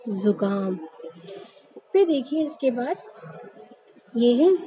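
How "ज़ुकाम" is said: जुकाम as "zukaam", low and softer; z sound in जुकाम. Shown as a demonstration. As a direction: start जुकाम on a buzzing z. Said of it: "सही उच्चारण इसका क्या होता है".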